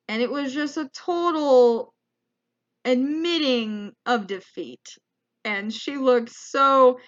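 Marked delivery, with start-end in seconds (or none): none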